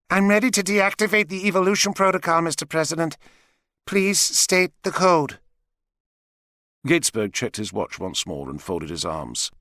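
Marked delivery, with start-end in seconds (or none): 0.1-5.4 measured, higher robot voice. Acted. Robot Eka speaks.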